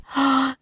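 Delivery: quick storyteller's gasp of alarm; startled gasp. A narrator's reading. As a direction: gasp as if danger just swooped in. Narrator gasps as eagle attacks.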